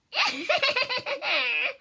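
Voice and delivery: high wicked witch cackle. Acted. Witch gloating by her oven, high evil cackle. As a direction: cackle with glee, high and far. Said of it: "heard her cackle".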